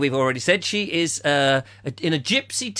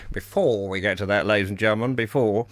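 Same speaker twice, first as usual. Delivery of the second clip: "before we get to that" said much lower, falling; lower aside repeat; 9 semitones lower than usual. Shown as a demonstration. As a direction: drop the voice for the repeat. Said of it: "repeated lower".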